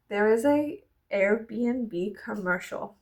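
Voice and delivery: normal talking voice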